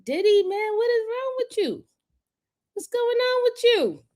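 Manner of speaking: high, disbelieving what-is-wrong-with-you; mock exasperation. Real, not acted. Host teasing a chatter named Diddy with high mock exasperation. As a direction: playfully scold him, pitch way up.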